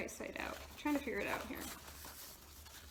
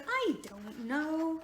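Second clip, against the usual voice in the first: slow drawn-out mock "I don't know"; "I don't know" dragged out slowly in his voice; imitating the car guy; 5 semitones higher, much slower than usual. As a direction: copy the TV mechanic's catchphrase, stretched slow. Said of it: "like the way he says it".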